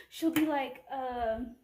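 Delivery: ordinary delivery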